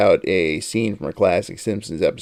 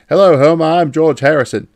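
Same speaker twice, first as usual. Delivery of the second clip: retold British accent, higher and louder; imitating a British accent, higher and louder; 6 semitones higher, 6 dB louder than usual. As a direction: greet him in a British accent. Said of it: "he did the whole British accent".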